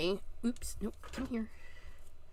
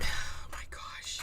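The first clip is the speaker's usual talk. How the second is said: breathless whisper of disbelief; 6 dB quieter, slower than usual. Real, not acted. Crafter spooked by the lid opening, whispering.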